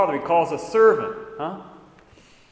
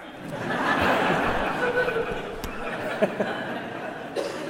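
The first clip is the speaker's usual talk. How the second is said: burst of laughter. Real, not acted. Laughing at the joke.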